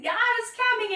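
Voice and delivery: high, ringing call; mock shout. John the Baptist shouting to the crowd, a high mock shout. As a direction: cry the warning out high. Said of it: "like, shouting".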